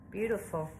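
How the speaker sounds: ordinary talk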